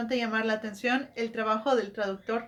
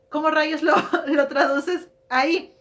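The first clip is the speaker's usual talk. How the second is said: laughing; 4 semitones higher, 5 dB louder than usual. Real, not acted. Vlogger laughing as she asks how to translate the joke. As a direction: ask it through real laughter, amused at the impossible joke.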